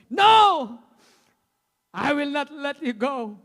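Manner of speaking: sudden high shouted refusal; Jacob shouting no. Acted. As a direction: yell it, holding on. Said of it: "Jacob refusing the angel".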